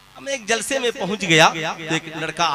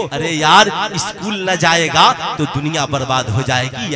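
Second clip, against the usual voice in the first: ordinary talk, nothing marked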